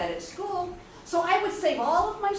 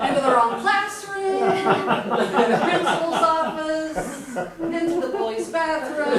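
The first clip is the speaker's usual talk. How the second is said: loud, higher-pitched comic list; comic exasperation; 4 semitones higher, 8 dB louder, slower than usual. Retold or imitated. Speaker listing wrong places she followed people into, comically exasperated. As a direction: call out each mishap over the laughter.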